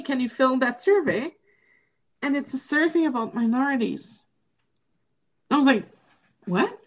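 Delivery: ordinary talk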